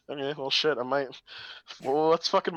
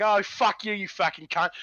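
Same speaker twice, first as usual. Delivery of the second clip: higher mock South London villain; South London accent; 3 semitones higher than usual. Villain swearing at Holmes, in South London accent. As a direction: vulgar South London outburst. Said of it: "they were constantly doing that".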